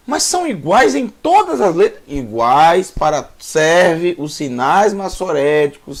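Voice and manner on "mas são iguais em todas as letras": voiced as a student, much higher pitch; student's question. Teacher voices the student's likely question, high-pitched imitation. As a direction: raise the pitch into a learner's query. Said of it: "Aí você vai dizer".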